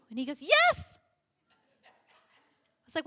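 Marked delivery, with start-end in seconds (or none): 0.4-1.0 very high, loud emphatic yes; man smugly insisting he's right. Retold or imitated.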